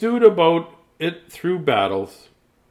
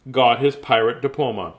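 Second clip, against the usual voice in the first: plain reading voice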